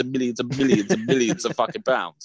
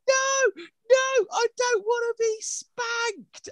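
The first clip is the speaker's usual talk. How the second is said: imitating a child's wailing, much higher, drawn out slowly; sob-shout it like a kid being dragged along; crying protest; much slower than usual. Daughter crying, dragged back in.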